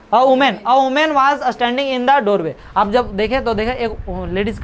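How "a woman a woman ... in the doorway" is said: slow, careful English reading; slow reading aloud. Shown as a demonstration. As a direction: read each word out slowly for the class. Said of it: "reading the text aloud".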